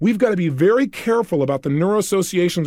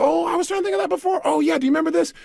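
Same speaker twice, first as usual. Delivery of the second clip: sudden recall; 11 semitones higher, faster than usual. Retold or imitated. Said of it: "You go".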